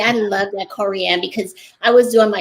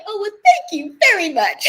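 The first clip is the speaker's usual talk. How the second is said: flattered thanks; 11 semitones higher, 5 dB louder than usual. Retold or imitated. Flattered reply retold to agent.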